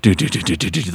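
ordinary delivery

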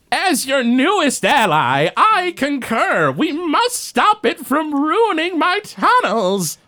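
high, slow pompous goblin voice; megalomaniac rant. Acted. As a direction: proclaim it like a petty tyrant. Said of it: "Blorgzak goes".

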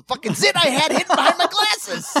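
loud, high, building to a yell; comic outrage. Real, not acted. Dad reveals what daughter's tender touch was for, outraged. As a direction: blurt the punchline in indignant disbelief. Outraged punchline of own story.

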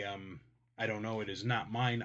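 ordinary talk, nothing marked

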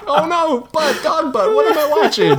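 high squeaky laughing mock horror. Cohost reenacting watching a dog's butt, squealing laughing mock horror. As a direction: squeal in giggly disbelief. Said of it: "I'm like".

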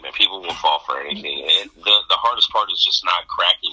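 ordinary talk

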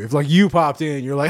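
usual speaking voice

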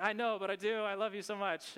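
mock lovestruck gushing. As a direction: gush it, playfully overdone. Replays his gushing young-love reply.

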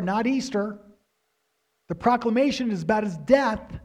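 high, loud, driving sermon delivery; preaching at full force. Acted. Pastor to congregation on the Lamb's death, preaching at full force.

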